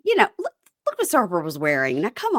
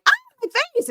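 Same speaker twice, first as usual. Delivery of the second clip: much higher, squealing cry of alarm; mock shriek; much faster than usual. Imagining friend Josh fleeing a fight, high mock squeal. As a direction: squeal like a vain guy protecting his face.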